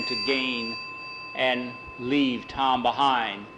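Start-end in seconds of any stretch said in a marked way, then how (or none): none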